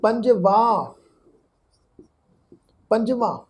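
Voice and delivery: ਪੰਜਵਾਂ said panjvan, clearly; careful pronunciation. Shown as a demonstration. Teacher showing how the numeral 5 is read, careful pronunciation. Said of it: "ਉਹ ਅਸੀਂ ਕਰਾਂਗੇ".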